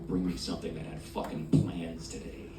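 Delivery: low, softly spoken demand; decadent order. Acted. Diner ordering at a decadent restaurant, low and soft.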